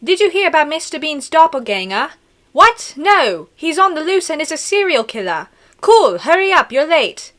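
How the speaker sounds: hammed-up British accent; put-on British accent. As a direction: read it as an excited British schoolkid story. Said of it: "Let me do a really good British accent".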